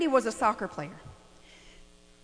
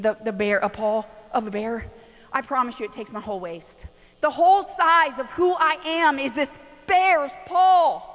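usual speaking voice

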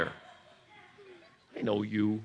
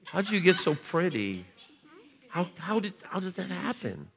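higher sing-song coo; 8 semitones higher, 5 dB quieter than usual. Real, not acted. Preacher cooing to a little girl with a bunny, higher.

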